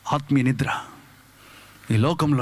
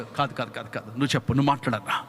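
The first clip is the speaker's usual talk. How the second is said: hushed refusal; 10 semitones lower, 6 dB quieter than usual. Retold or imitated. Friend quietly refusing the money.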